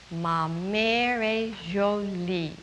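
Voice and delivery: slow, careful "Ma mère est jolie"; model French line. Shown as a demonstration. Teacher modelling the line for children to repeat, slow and clear. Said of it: "here's the way you say it in French".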